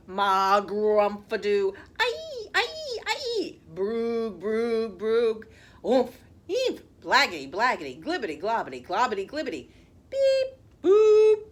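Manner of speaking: loud, wild nonsense gibberish; silly sounds. Acted. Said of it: "make silly sounds like".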